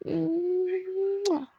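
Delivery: soft, drawn-out smooch; a slow little mwah; cute kiss sound. Acted. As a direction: blow a playful kiss. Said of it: "cute से किस कर दिया".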